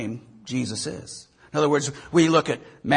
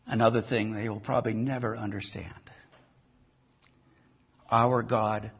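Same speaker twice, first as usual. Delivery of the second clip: closing prayer. Acted. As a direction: pray humbly over the congregation. Pastor leads closing prayer.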